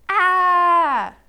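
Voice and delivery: loud high sustained "ah"; baby yell. A narrator's reading. Baby awake in the night, yelling. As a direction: let out a long acted baby yell. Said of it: "Baby yelled a bit".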